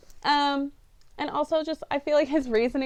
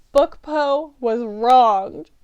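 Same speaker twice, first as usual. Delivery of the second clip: slow and louder, each word weighed; indignant verdict; 8 dB louder, much slower than usual. Real, not acted. Reviewer protesting the movie's changes to viewers, slow and louder. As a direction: declare it like a verdict.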